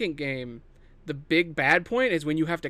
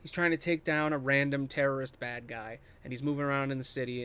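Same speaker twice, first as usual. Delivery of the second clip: usual speaking voice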